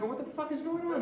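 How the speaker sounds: high, incredulous squeal of confusion; baffled outburst. Retold or imitated. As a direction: pitch shoots way up in disbelief. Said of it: "I'm like".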